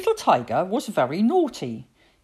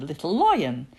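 plain reading voice

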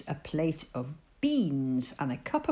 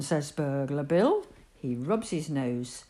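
plain reading voice

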